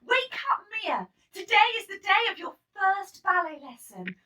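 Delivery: high, loud wake-up call; mother calling daughters awake; cheerful call. A narrator's reading. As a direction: call the girls out of bed. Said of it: "Mama called one Saturday morning".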